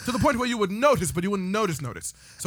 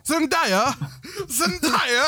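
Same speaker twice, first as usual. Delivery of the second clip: hammed-up British, slightly higher; mock British accent; 4 semitones higher, much slower than usual. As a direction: put on a bad British accent. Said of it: "Your accents are so insulting".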